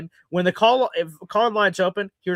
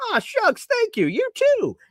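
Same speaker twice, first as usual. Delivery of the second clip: a much higher, coy aw-shucks voice; mock bashful; slower than usual. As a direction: play the flattered host, hamming it up. Mock-bashful reply to compliment.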